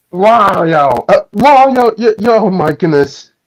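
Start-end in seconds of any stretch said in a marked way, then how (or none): none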